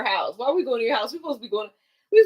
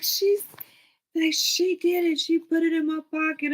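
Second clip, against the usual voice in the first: higher mock brother voice blaming me; brother's whiny blame; 6 semitones higher than usual. Brother blaming his little sister, higher whiny mock voice. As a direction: whine it like the accusing big brother.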